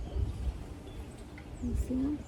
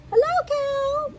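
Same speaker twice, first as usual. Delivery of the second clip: very high squeaky duck greeting; cheerful little cartoon duck, drawn out; duck character; 7 dB louder, slower than usual. A narrator's reading. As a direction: call out in a tiny, high-pitched duck voice. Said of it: "said Duck".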